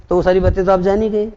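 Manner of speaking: ordinary talk, nothing marked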